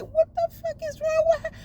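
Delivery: high, stunned outburst of disbelief; shocked disbelief. Retold or imitated. Retelling seeing the girl on the highway, shocked high outburst. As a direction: blurt it out, half shocked. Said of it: "And I was like".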